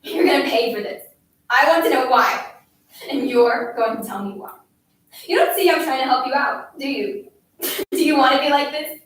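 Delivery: loud, hectoring tirade; angry scolding. A narrator's reading. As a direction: berate the child, pushing out each question. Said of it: "I scolded".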